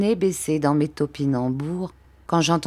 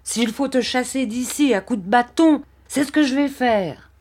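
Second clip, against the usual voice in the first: raised, high-pitched threat; terrible anger; 9 semitones higher than usual. A narrator's reading. Barberin threatens to drive the boy out, furious. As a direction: threaten in a temper, pitch well up. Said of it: "qui se mit dans une terrible colère".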